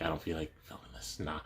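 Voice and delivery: very soft, low aside; retold quiet inner thought; low-key refusal. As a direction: mumble it to yourself. Own thought while sitting at security.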